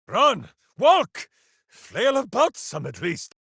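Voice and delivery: loud, high barked orders; urgent shout. Acted. Ranger yelling at allies to run, loud and urgent. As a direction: hurry everyone along, loud. Urging companions to flee.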